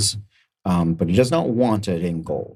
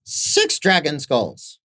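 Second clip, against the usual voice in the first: high, drawn-out squeaky creature; small trader's slow, high-pitched demand; little merchant naming price; much slower than usual. Acted. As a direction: stall high, then slowly announce it. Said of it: "and he goes".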